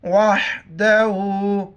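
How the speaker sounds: वहदहु with the ha from the throat; slow वहदहु with a throat-made ह; throaty ha. Shown as a demonstration. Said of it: "हा को गले से करेंगे".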